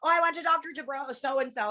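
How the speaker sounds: high, put-on patient voice; mock sheepish patient. Patient naming her surgeon to a stranger, high mock voice. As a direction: play the embarrassed patient owning up.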